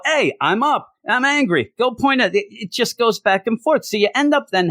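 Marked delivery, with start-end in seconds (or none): 0.0-2.5 mock hero lines. Mocking comic dialogue.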